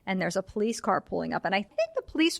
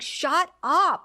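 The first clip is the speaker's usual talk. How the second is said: higher-pitched imitation of an irritated order; exasperated retort; 8 semitones higher, 4 dB louder than usual. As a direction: act out being fed up with screaming. Retold snapping at screaming Blanche.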